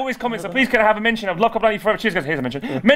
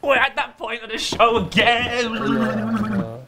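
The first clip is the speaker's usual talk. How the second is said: hammed-up hype; 5 semitones higher, much slower than usual. Hyping for quick fire round.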